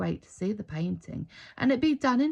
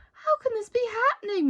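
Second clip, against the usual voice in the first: much higher, distressed disbelief; dismayed cry; 14 semitones higher, 3 dB louder than usual. A narrator's reading. Mother seeing the water-stained church wall, crying out in dismay. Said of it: "Missus Weeks cried".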